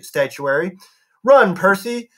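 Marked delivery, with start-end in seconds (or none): 1.2-2.1 higher-pitched urgent call; raised, urgent shout, not full force; urgent warning. A narrator's reading. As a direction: cry out a warning to flee. Annabeth shouting a warning to Percy.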